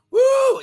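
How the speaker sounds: high, loud "woo"; ecstatic whoop. Real, not acted. Preacher caught up in praise, ecstatic whoop.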